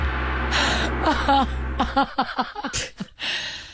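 high breathless wheezing laugh; real giggle fit, almost crying; helpless laughing fit. Real, not acted. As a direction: laugh until it squeaks out.

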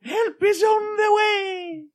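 put-on very high, slow old-lady falsetto; Mrs Doubtfire falsetto. Playing the Scottish nanny serving tea, high old-lady falsetto. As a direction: coo it in a sky-high granny voice. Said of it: "You're doing Missus Doubtfire".

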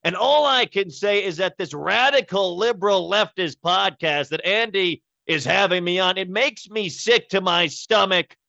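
high, nasal senator voice; nasal outraged senator; Ted Cruz impression. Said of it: "you also get Ted Cruz".

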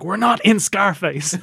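high, loud, drawn-out mock protest; mock criticizing. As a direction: imitate a gangster's exasperated complaint. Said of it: "are criticizing Francois's handling".

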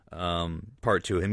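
ordinary talk, nothing marked

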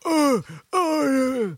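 high groaning zombie grunts. Retold or imitated. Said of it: "I love Ed's where he's like".

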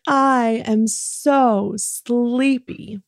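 very slow, high sleepy whine; dramatic sleepy. Shown as a demonstration. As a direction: ham up being sleepy. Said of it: "and say it".

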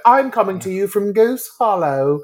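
lightly put-on British accent, playful. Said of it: "throw in a British accent".

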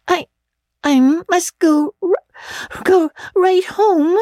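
high, slow, tearful slurred plea; thick, tearful. Acted. Dizzy girl insisting to her friend she must leave, tearful. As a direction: drag it out, near tears. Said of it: "a little thickly".

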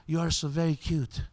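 lightly imitated French accent; a retold compliment with a touch of French accent; hint of a French girl's accent. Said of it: "said in her little French accent".

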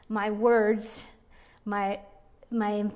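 normal talking voice

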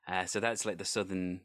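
normal talking voice

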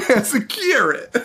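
high-pitched spoof announcer, breaking into laughter; trailer announcer parody. Retold or imitated. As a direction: deliver a silly trailer punchline through laughs. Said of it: "the South Park Rob Schneider trailers".

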